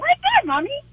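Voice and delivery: put-on high little-girl voice; toddler voice. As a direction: pipe up like a two-year-old. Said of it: "she's like".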